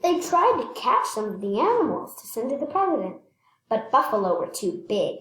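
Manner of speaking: ordinary delivery, nothing marked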